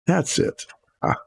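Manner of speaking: sudden relief, found it; pleased discovery. Real, not acted. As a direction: pitch jumps up on finding it. Finds the paint he sought.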